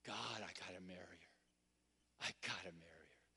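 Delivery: fast unvoiced whisper, lovesick and urgent. Lovesick young man whispering to God, hushed and rushed. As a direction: breathe it out like a secret plea.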